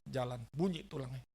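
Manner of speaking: usual speaking voice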